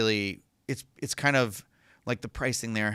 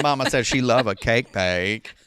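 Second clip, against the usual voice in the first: loud, playful movie-character impression; hammed-up Forrest Gump voice; 5 dB louder than usual. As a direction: do Forrest Gump's cadence, amused. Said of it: "it was very Forrest Gump".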